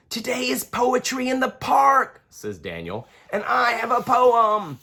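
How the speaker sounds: put-on bright curious kid asking; young boy Daniel. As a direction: ask like a curious little boy. Daniel child voice.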